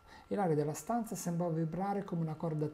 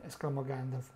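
ordinary delivery

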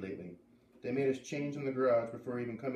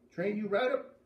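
put-on Maine accent; 8 semitones higher, 4 dB louder than usual. Zep repeats.

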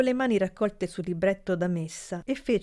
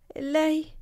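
much higher, hesitant stammer; 10 semitones higher than usual. A narrator's reading. Niece stammers to her uncle, timid. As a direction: falter it out shyly. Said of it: "balbettò la fanciulla".

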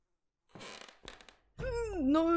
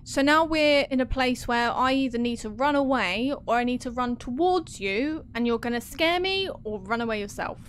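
ordinary talk, nothing marked

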